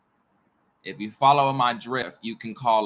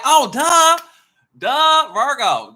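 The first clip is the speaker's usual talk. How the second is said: high, loud dopey neighbor voice; mocking the neighbor; 12 dB louder, slower than usual. Imitating her neighbor's smug duh, high and loud. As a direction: play the neighbor as a know-it-all dimwit. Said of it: "my neighbor was like".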